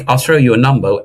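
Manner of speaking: ordinary talk, nothing marked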